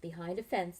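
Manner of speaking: ordinary delivery, nothing marked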